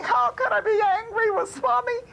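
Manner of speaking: high-pitched, disbelieving retold thought; incredulous protest. Devotee baffled by Swami's accusation, incredulous. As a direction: voice leaps up in bewildered denial.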